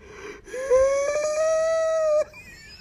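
long, very high-pitched crying wail; re-enacted ugly cry; mock crying wail. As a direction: let out a loud, drawn-out ugly cry. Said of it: "and I start crying".

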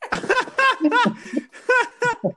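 high, loud burst of laughter; laughing. Real, not acted. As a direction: talk through real laughter. Cracking up at a bad pun.

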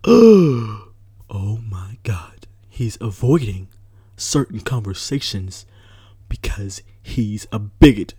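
hammed-up scandalised gossip, breathy and slow; mock outrage. Imitating people accusing him of being a bigot, in mock horror. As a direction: act out the shocked, whispering accuser. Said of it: "without people being like".

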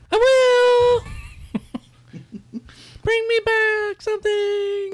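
put-on very high, drawn-out kid voice; little kid calling from down the road; child calling back. Departing child calls back to the group, high drawn-out kid voice. Child answers while running away.

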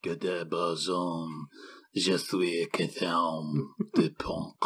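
slow, careful mock French accent; French attempt. Shown as a demonstration. Cohost attempting the French director's name, mock French. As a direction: try out the French name slowly. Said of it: "I think that's how it goes".

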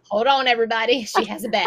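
loud mock announcement to a room; mock calling out. Joking as staff who spot her bag, calling out. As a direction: call out over everyone, playfully.

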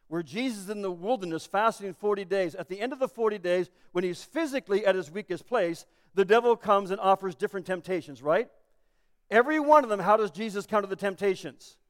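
ordinary talk, nothing marked

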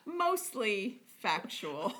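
slow, laughing through it; giggling at her own joke. Real, not acted. Joking about made-up facts.

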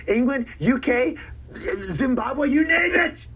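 rising bragging exclamation; excited boast. Real, not acted. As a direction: build up to a triumphant shout. Boasting list of countries.

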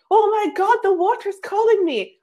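high, eager squeal of delight; breathless thrill at getting in the water; giddy excitement. Retold or imitated. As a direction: gush like the sea is calling you. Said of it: "I'm like".

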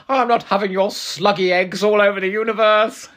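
loud, high theatrical declaiming; big campy actor's bellow; Colin Baker impression. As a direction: ham it up at full, camp outrage. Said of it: "Colin Baker walks in on Mestor and goes".

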